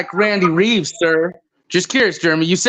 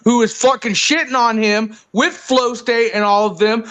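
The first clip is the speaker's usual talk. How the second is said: ordinary delivery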